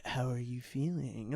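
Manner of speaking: low, slow, hushed mock-seductive voice; weird sexy. Actress purring into a phone close-up, mock sexy. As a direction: imitate an oddly sultry close-up line, softly. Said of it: "trying to be, like, weird and sexy".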